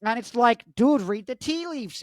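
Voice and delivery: high, strained put-on voice, rising to loud exclamation; exasperated high caricature of a professor; Jordan Peterson impression. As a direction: build into high-pitched indignant mimicry. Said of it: "It's like Jordan Peterson impression".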